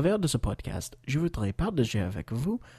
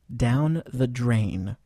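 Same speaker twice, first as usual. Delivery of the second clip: very slow, careful teacher model; slow model phrase; much slower than usual. Shown as a demonstration. Teacher models the phrase slowly for learners. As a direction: stretch it out for students to repeat. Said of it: "écoutez et répétez".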